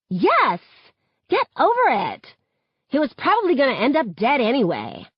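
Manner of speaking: high, exasperated snapping; heated retort. A narrator's reading. Schoolgirl dismissing a murdered boy's death, heated retort. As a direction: snap back impatiently, pitched high. Acted quarrel, callous retort.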